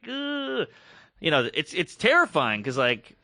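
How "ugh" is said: high, soft drawn-out "ugh"; disgusted groan. Retold or imitated. Producer reacting to the dwarf joke, high disgusted groan. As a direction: groan high in mock distaste. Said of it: "he was like".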